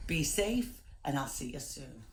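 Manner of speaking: normal talking voice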